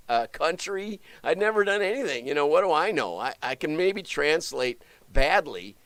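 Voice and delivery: ordinary delivery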